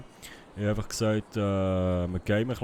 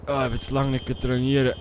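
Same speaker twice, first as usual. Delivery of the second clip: ordinary delivery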